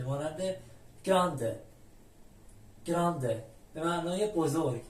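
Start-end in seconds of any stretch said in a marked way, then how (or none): 1.0-1.6 گرانده with a hard g before r; original g sound. Shown as a demonstration. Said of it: "صدای اوریجینال خودش یعنی گ". 2.8-3.4 گرانده with a hard g before r; original g sound. Shown as a demonstration. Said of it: "صدای اوریجینال خودش یعنی گ".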